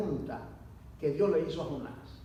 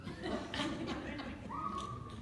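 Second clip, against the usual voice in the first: risa soplada y aguda; 9 semitones higher, 11 dB quieter than usual. Real, not acted. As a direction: se ríe de su propio grito. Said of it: "risa tras el grito". (el predicador se ríe de su grito)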